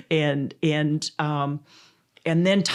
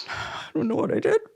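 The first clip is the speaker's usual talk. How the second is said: high, breathless whispered panic; panicked whisper; 12 semitones higher than usual. Retold or imitated. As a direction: whisper it like you're caught out. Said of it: "of course I'm going like".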